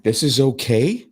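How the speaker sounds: slow, high, loud disbelief; incredulous question. Acted. Man appalled at bystanders filming an assault, incredulous. Mocking bystanders who just watch.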